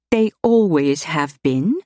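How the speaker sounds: slow, guarded agreement; warily. A narrator's reading. Jess warily agrees that the paintings are admired. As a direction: hold back, not quite trusting. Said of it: "Jess agreed warily".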